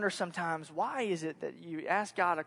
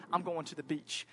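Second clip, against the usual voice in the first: usual speaking voice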